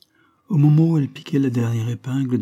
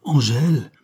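higher, louder call to hold someone back; crying out; 5 semitones higher, 6 dB louder than usual. A narrator's reading. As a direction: call the name out in mild alarm. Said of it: "s'écria Prosper en essayant de la retenir". (s'écrier pour la retenir)

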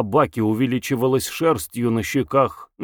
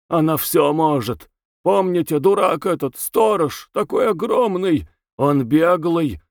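high, rushed hysterical pleading; feverish, frantic prisoner; 6 semitones higher than usual. A narrator's reading. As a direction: clutch at him and beg. Bezbedov raves about her. (горячечная, истеричная мольба)

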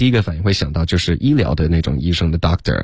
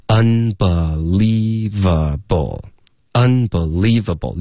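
very slow "unbelievable", stressing UN and LIE; two stresses; much slower than usual. Shown as a demonstration. Teacher drilling the word for learners, two stresses. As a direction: draw out "unbelievable" with weight on un and lie. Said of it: "两个重音".

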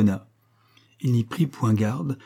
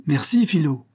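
higher grateful exclamation; joyful cry; 5 semitones higher, 4 dB louder than usual. A narrator's reading. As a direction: thank her exuberantly. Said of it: "cria Jacques en l'embrassant". (cri de gratitude, voix plus haute)

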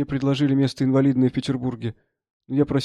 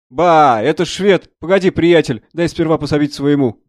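raised exclamation, then putting him off; exclaim, then make him wait; loud surprised doctor; 3 semitones higher, 7 dB louder, slower than usual. Acted. Doctor finding a Swede among the wounded, loudly exclaiming.

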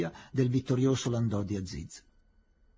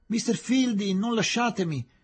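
much higher, louder pleading cry; broken-voiced plea; 10 semitones higher, 3 dB louder than usual. A narrator's reading. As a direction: beg him not to leave you. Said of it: "gridò la voce rotta di Aziz". (supplica gridata con voce rotta)

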